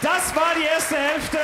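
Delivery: high, loud laughing halftime call; laughing announcement. Real, not acted.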